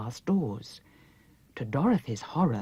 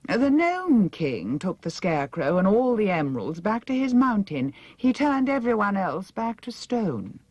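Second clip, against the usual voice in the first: languid vain princess giving orders; haughty princess; 7 semitones higher, 5 dB louder than usual. A narrator's reading. Mombi answering.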